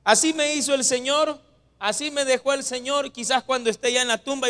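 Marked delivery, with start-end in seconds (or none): none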